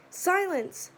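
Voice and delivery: loud, high command; yelling. Acted. Teacher silencing a noisy class, loud yell. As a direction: shout the class down. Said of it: "he yells".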